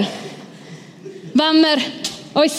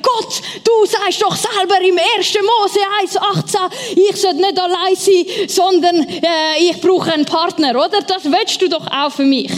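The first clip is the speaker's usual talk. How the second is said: imitating an earnest, pleading prayer, higher; mock fervent prayer, pitched up; 5 semitones higher than usual. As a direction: retell begging God with fervour. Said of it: "mit Inbrunst habe ich betet".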